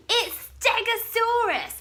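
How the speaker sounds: high, loud mocking laugh. Bonehead laughing at his scared friends, high and loud. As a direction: crow with glee at the trick. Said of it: "Bonehead laughed".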